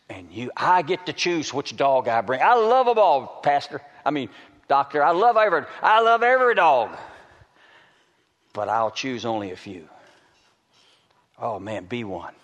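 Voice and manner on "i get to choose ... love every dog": full-force preaching. Real, not acted. Preacher declares his love for every dog, at full force. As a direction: belt out each LOVE, high and stretched.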